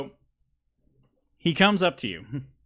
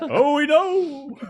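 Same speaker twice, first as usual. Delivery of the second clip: slow, loud, very high mock cry; hammed-up high wail; high drawn-out wail; 8 dB louder, much slower than usual. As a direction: stretch it out in a high, loud squeal. Acted group cry in story.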